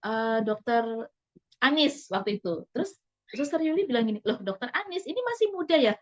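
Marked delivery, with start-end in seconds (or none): 4.3-5.9 surprised nurse. Retold or imitated. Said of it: "dia tuh kaget".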